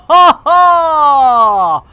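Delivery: high, loud hearty laugh. Real, not acted. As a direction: burst out laughing. Laughing at banana joke.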